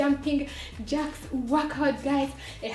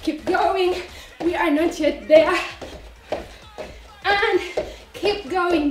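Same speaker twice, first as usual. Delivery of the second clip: workout coach; 3 semitones higher than usual. Acted. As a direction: pump up the class like a coach. Energetic workout cues.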